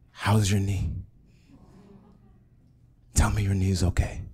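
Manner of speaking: imitating a frail mother, fading towards a whisper; halting, with a long pause, lightly voiced weakness; weakly mustered words. Mother straining to ask her child about the knee, weakly. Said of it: "trying to muster some strength".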